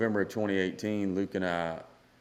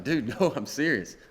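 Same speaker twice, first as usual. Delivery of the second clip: higher, earnest insistence, retold; pleading to be believed, pitched up; insistent; 6 semitones higher, 4 dB louder than usual. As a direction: imitate a buddy insisting it's true. Retold friend insisting on the news.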